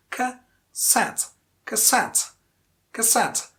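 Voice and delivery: CASSETTE with a short first syllable and a long, strong SETTE; ca-SETTE, stretch the end of cassette; second-syllable stress. Shown as a demonstration. Teacher modelling cassette for learners, stressed on SETTE. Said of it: "stress falls on the second syllable".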